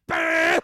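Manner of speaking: high, unvoiced bam; mock music blast. Describing theater music hitting him, mock blast. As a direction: burst it out like a sound hit. Mimicking loud movie music.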